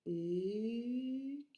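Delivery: Écureuil very slow, each syllable held; "Écureuil" stretched into é, cu, reuil; slow syllable breakdown. Shown as a demonstration. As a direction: take "Écureuil" apart gently, syllable by syllable. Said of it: "prononce le mot très doucement pour le décomposer".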